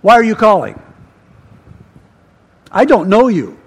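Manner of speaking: loud, curt phone challenge; firm and loud, in separate jabs; suspicious challenge. Retold or imitated. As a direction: demand it like you distrust the caller. Acted phone answer, wary stranger.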